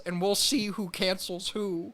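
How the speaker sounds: high, mock-tearful impression; Jordan Peterson. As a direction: imitate someone about to cry. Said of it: "sounds like he's about to cry".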